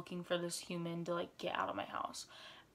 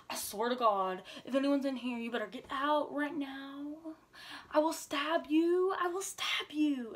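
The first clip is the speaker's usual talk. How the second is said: mock-fierce warning building higher and louder; mama bear threat; 6 semitones higher, 3 dB louder than usual. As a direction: imitate a protective grandma threatening an intruder. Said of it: "such a mama bear".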